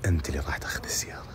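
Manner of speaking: fast secret whisper. Real, not acted. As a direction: breathe it quickly in his ear. Secret news, then said aloud.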